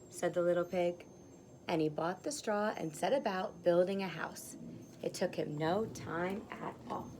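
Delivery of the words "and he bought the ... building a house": usual speaking voice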